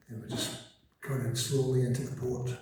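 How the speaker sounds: usual speaking voice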